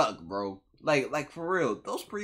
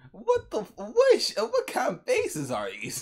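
shocked outburst; 9 semitones higher, 5 dB louder than usual. Real, not acted. Shocked by character faces.

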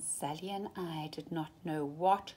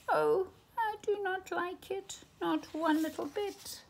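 much higher, squeaky worried voice; fretful fish; 11 semitones higher than usual. Acted. Fish in the pot complains to the cat, high and fretful. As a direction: whine it like a fussy little fish.

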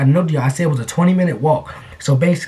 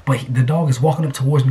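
usual speaking voice

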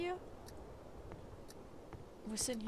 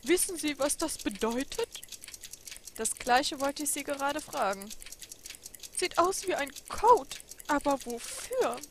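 high, loud, searching; an old man squinting at a riddle; puzzled old clockmaker; 4 semitones higher, 7 dB louder than usual. Acted. Clockmaker puzzles over a coded note, high voice.

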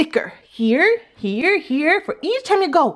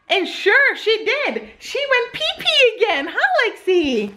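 high, slow, doting praise; potty training praise; 8 semitones higher, slower than usual. Real, not acted. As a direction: gush over her proudly. Praising toddler.

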